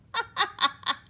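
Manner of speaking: loud high cackling laugh; ghost laugh. A narrator's reading. Grubble laughing at scared Hugly, loud high laugh. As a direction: laugh at the fright. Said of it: "The ghost laughed".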